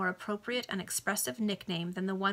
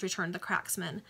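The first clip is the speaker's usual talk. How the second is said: plain reading voice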